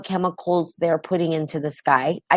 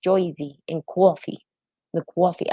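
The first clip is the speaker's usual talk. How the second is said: amateur, badly faked Jersey accent; 3 dB quieter than usual. Asking viewers if they talk like that, mock Jersey accent. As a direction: have a clumsy go at Jersey vowels. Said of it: "I'm probably really bad at my accents".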